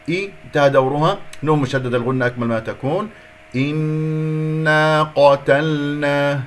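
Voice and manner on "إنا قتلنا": إنا قتلنا chanted with a short i and long humming n; correct ghunna. Shown as a demonstration. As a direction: hold the doubled n of إنا very long. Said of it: "هكذا".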